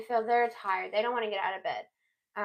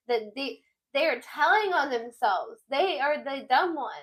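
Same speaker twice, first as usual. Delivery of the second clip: ordinary talk, nothing marked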